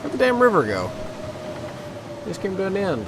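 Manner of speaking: surprised outburst. Real, not acted. River suddenly ends, frustrated.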